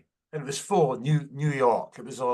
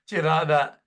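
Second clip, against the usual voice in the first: ordinary delivery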